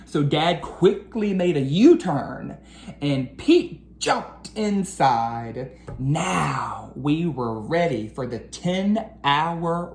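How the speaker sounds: lively storybook read-aloud; reading to children. A narrator's reading. Teacher reading a picture book to her class, lively storytelling. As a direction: read the rhyming story to the kids.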